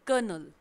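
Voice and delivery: कर्नल said as "kernel" without r; say colonel. Shown as a demonstration. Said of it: "Word of the day". (कर्नल बिना आर के, साफ़ और धीरे)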